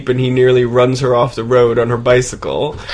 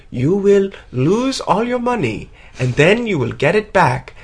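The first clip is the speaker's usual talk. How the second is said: impersonating the palm reader; 6 semitones higher, 3 dB quieter than usual. Retold or imitated. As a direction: intone the prophecy like a mystic. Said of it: "this impersonation".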